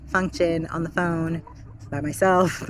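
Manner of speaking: normal talking voice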